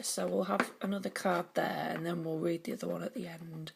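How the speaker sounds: normal talking voice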